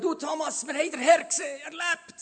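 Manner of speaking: high, loud, tumbling over each other; excited disciples. Acted. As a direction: burst out with the good news. Disciples excitedly telling Thomas. (aufgeregte Jünger, hoch und laut)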